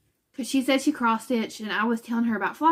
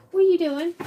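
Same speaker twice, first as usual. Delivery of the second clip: quick high, louder call to the dog; a sudden high-pitched question to the dog; talking to the dog; 8 semitones higher, 5 dB louder, faster than usual. Real, not acted. Talking to her dog in corner.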